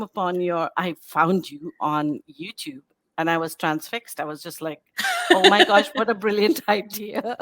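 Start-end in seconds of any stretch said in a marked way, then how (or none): 5.3-7.4 excited gush. Retold or imitated. Transfixed by the YouTube video.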